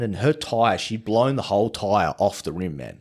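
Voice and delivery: normal talking voice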